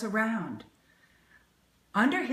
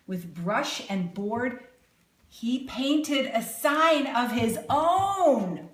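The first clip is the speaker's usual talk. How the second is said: plain reading voice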